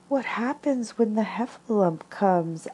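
high, small, trembling voice; trembling Piglet. Acted. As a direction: ask it like a frightened little piglet. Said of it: "asked Piglet, trembling".

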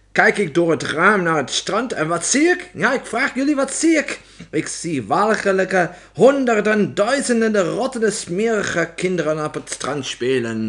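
loud, high tirade building in outrage; ranting witch. A narrator's reading. Opperheks disgusted by children on the beach, ranting. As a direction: rail at the crowd, pitch climbing.

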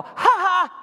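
put-on a child's gloating "ha ha"; bratty little giggle; mocking kid laugh. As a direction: tease like a smug toddler. Toddler mocking taunt.

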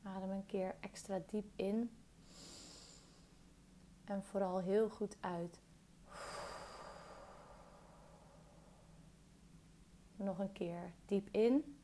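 calm meditation guide, long pauses between phrases; guided meditation. Real, not acted. Guiding a listener through a body scan, calm meditation voice.

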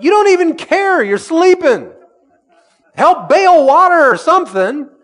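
high, loud exasperated cry; accusing panic. Retold or imitated. Disciples berating the sleeping teacher, high exasperated shout. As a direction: shout at him for sleeping through it.

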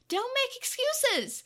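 high, slow, loud rebuke; angry cut-off. A narrator's reading. Takeru interrupts Tokomon's defence, angry rebuke. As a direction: shut the excuse down hard.

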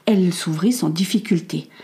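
ordinary delivery, nothing marked

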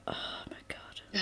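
barely audible, whispered dismay; hushed aside in disbelief; exasperated whisper. Retold or imitated. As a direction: whisper it under your breath. Imagined exasperated aside of others.

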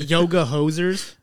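slow, loud disbelief; drawn out in disbelief; incredulous. Real, not acted. Reading odd movie title in disbelief.

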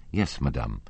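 ordinary delivery, nothing marked

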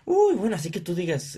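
loud high scoffing uy; mock scoff. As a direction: scoff at the budget. Coworker scoffing at price. (compañero burlándose del precio)

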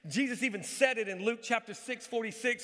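ordinary talk

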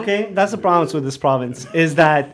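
hammed-up grievance, half laughing; mock anger. Guest mock-ranting about the province, hammed-up anger. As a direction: play at being fed up, loud and jokey. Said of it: "Il est fâché".

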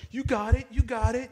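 very high coddling cheer; mock cheering friend. Comic retelling friends cheering a bombing comic, high baby-talk cheer. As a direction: cheer him on like a toddler learning to walk. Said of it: "you hear someone go".

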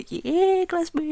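very high, drawn-out little cheer; mock cheer. Students hearing their class was picked, imitated high cheer. Class reacting to announcement, retold.

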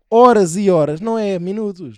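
loud, drawn-out emphasis; emphatic. Real, not acted. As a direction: stress how long it takes. Stressing how long birdwatching takes.

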